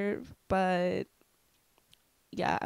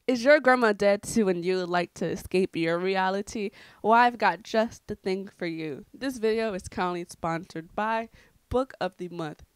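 louder mock-infomercial pitch; sponsor ad read; 6 dB louder than usual. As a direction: sell it like a cheeky commercial announcer.

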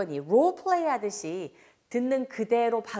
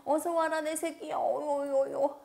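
cooing mom; 5 semitones higher than usual. Pretend. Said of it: "우리말에 가장 딱 맞아떨어지는".

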